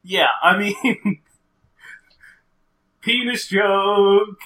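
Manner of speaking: slow, breathy laugh through the words; words squeezed out while laughing. Real, not acted.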